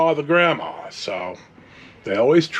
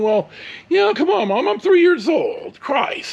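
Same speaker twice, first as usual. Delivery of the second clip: exasperated retort; 12 semitones higher than usual. Retold or imitated. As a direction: throw up your hands: I was three. Retorting to mom in his head.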